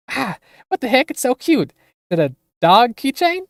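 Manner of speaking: high, bright thrill over a cute gift; delighted squeal. Acted. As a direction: gush over the little keychain.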